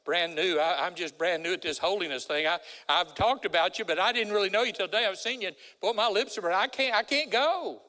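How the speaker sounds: Isaiah begging off, rising to a desperate cry; Isaiah pleading. Acted. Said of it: "Isaiah could say".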